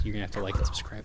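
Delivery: soft fast ASMR whisper. Pretend. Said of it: "My A S M R podcast".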